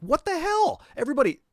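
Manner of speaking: a sudden high, loud shout of disbelief; outraged yell. Retold or imitated. Quoting his outburst at the GM who switched off the TV, loud and high. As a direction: yell it at the manager like you can't believe it. Retold clash with the manager.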